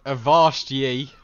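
slow, loud pirate growl. Acted. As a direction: bark it like a pirate. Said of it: "I'm not good at accent".

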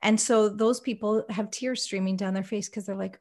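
ordinary talk

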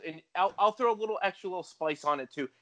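normal talking voice

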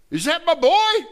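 high, loud cry of recognition; joyful cry. Acted. Father spotting his lost son, a high loud cry. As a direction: call it out in sudden joy. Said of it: "But I think it's my boy".

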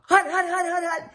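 high, rapid-fire quarterback hut calls; mock-yell the snap count without full volume. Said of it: "I'd just yell out some stuff".

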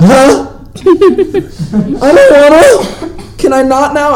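mock dismay. Reluctant reaction to assigned scene.

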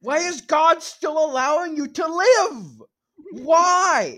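high, loud wailing outrage; anguished mock rant. Berating an absent filmmaker for his faith, hammed-up anguished shouting. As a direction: wail and berate as if personally betrayed.

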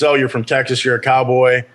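ordinary talk

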